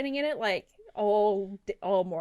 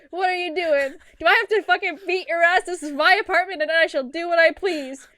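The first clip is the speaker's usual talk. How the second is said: louder, higher, building to a mock threat; mock inner rage; 5 semitones higher, 6 dB louder than usual. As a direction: puff up and defend your turf, hammed-up. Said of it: "I'm sitting here like".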